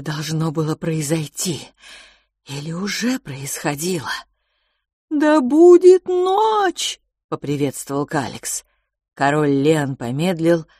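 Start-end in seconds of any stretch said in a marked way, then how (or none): 5.1-7.0 high, loud, slow proclamation; booming greeting. Acted. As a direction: call it out like a ritual. Calix booming ritual greeting. (громкое торжественное приветствие)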